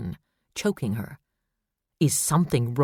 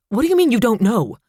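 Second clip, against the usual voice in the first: shouting, high and loud; furious hospital chief demanding answers; loud raw demand; 8 semitones higher, 6 dB louder than usual. A narrator's reading. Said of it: "she demanded, her voice loud and raw".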